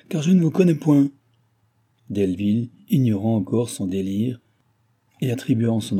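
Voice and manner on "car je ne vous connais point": louder, higher, impatient denial. A narrator's reading. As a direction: disown him with impatience. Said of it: "s'écria-t-elle d'un ton d'impatience".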